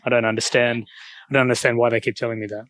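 ordinary talk

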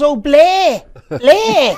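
put-on high, loud Liverpool-accent "Blay"; mock Scouse Blay. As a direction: imitate the Scouse friend saying "Blay, Blay". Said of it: "she goes".

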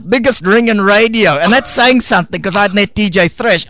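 normal talking voice

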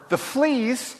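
high, incredulous question. A narrator's reading. Corrie cannot accept thanks for fleas, incredulous. Corrie interrupts, this was too much.

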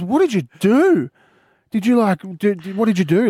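put-on high, incredulous kid's grilling; daughter's astonished questioning. Daughter's shocked reaction to arrest.

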